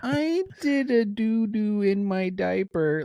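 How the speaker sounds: put-on whiny little kid voice; high, slow babyish whine; bad acting. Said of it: "he's like".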